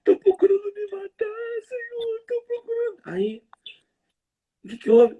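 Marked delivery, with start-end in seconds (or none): 0.0-3.0 high, desperate plea sinking to a whisper; frightened prayer. Retold or imitated. Elias hiding from Jezabel, pleading in fear. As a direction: beg God in panic, fading to a whisper. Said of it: "Aí depois ele pensa".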